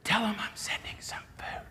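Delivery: soft unvoiced whisper; God whispering a promise to Moses; gentle whisper. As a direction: breathe it like a tender secret. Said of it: "the God of the universe just says".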